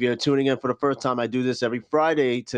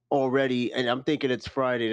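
normal talking voice

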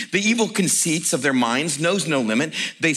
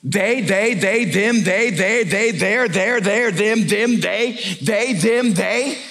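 "they, them, there" fired off in a raised rhythmic chant; agitated finger-pointing; 4 semitones higher, much slower than usual. Acted. Friend blaming everyone else to preacher, agitated chant. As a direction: rattle each "they" and "them" with rising pitch, no let-up. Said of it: "but you wanna know what's going on?".

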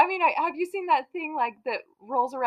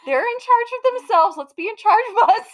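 exasperated mom; 10 semitones higher, 7 dB louder than usual. Retold or imitated. Retelling own reply to son.

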